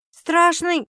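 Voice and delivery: high, squeamish question; disgusted. A narrator's reading. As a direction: ask with a touch of distaste. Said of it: "брезгливо уточнила сестра". (брезгливо, с повышением голоса)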